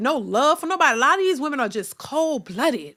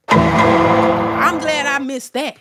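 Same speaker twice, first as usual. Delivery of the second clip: drawn-out sarcastic relief; 7 dB louder, much slower than usual. Real, not acted.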